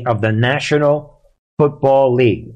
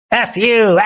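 a touch of a raised, defiant jeer; mock yell at cops; 8 semitones higher than usual. Commentator mimics criminals taunting police, mock yell. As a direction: toss a cheeky insult at the cops.

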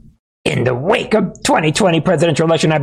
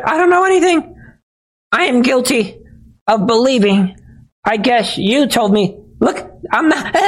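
much higher, whiny panicky voice; mock weepy underling; 10 semitones higher than usual. Host mocking soldiers lining up to confess, high whiny mock denial. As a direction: squeal out a guilty denial. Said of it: "they're like".

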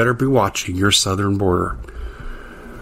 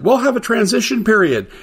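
a much higher, sing-song mock of the promise; mocking Biden; 12 semitones higher than usual. Radio host sneering at Biden's promise, high mocking voice. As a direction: pitch it way up to ridicule the quote.

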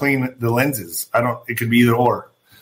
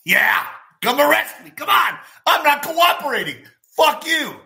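high, shouting taunt from a man daring the cops; mock taunting yell; 10 semitones higher than usual. Comedian mimicking a defiant man yelling at police. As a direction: yell it like a defiant guy begging to be arrested.

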